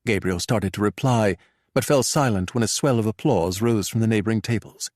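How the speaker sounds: ordinary delivery, nothing marked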